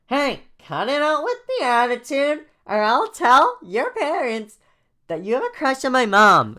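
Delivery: put-on very high, loud childish threat; scolding young kid. Jaden threatening to tell Joey's parents, loud childish voice. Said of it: "Jane talks like a seven-year-old".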